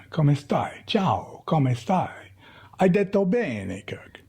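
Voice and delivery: mother's light Italian greeting; Italian accent. Retold or imitated. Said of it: "With the accent, proper accent".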